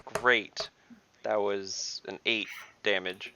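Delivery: usual speaking voice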